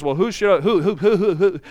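mock stutter, stumbling over "who"; comically exaggerated stammer; stuttering Moses. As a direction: get stuck repeating the word, wavering. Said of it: "He stuttered".

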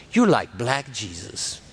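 slow, low punchline; mock solemn. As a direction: draw it out low, as deadpan reverence. Wife's punchline, delivered gravely.

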